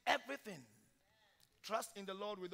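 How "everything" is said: loud, high preaching cry; preaching at full force. Acted. Preacher exhorting the church in a loud preaching cry. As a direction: drive each line home like a revival preacher.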